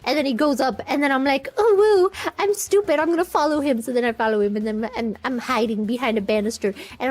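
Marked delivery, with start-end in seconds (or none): 1.6-3.8 high cutesy voice, loud opening coo; exaggerated cute "oh, woo"; cute innocent human. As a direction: play up naive little-human innocence. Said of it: "being a cute, innocent little, little human".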